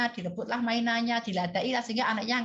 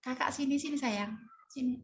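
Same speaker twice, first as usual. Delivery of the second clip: soft, tender call, higher and softer; softest voice; 4 semitones higher, 3 dB quieter than usual. Retold or imitated. Mother softly calls daughter instead of scolding, tender. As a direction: coax her near as gently as possible. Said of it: "saya lembutkan suara saya menjadi suara yang paling lembut".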